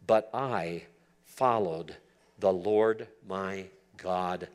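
ordinary talk

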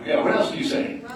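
high, loud coaxing prompt; hyped crowd warm up. Acted. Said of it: "I wanna hear back from you".

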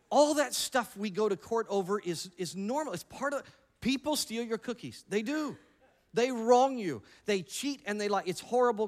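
worked up. Real, not acted. Listing petty wrongs, mocking.

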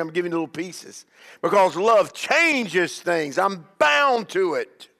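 rising, emphatic sermon cadence; fiery preacher driving the point home; preaching at full force. Acted. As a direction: preach it, building to each "Why?".

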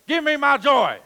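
loud, high fist-shaking demand; hammed-up angry demand; mock demand at God. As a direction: shout it up at God like a spoiled child. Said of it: "shake your fist at God and say".

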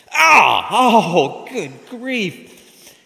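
high shouted howl, dying away; hammed-up wailing yell; mock agony. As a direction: cry out in comic pain. Patient touches elbow in pain.

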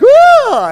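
very high, loud imitated squeal; loud baby squeal. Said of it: "he lets out a loud squeal".

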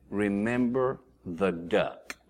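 low, slow, soft mock menace; veiled threat. [name] reminds Ray of his secret, low mock-menacing reminder. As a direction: drop it low and draw it out.

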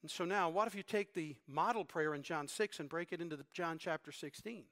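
ordinary talk, nothing marked

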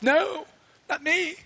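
high, slow panicky denial; hammed-up frightened Peter; mock denial. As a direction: Peter denying to the girl, high and scared.

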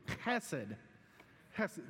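"Chesed" with a throaty ch, led for the room; Hebrew guttural ch. Shown as a demonstration. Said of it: "can you say it with me?".

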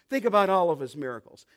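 ordinary talk

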